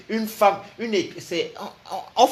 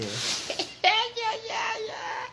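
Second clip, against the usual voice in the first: very high, screaming laugh; high cackling scream of laughter; shrieking laugh; 5 dB quieter than usual. Real, not acted. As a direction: burst into a wild shrieking laugh.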